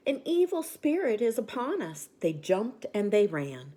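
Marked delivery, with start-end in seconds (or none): 0.1-2.1 panicked cry. A narrator's reading. Animals fleeing a ghost.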